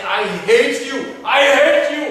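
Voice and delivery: high yell building to a scream; acted child's tantrum. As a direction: scream it as a furious child, escalating.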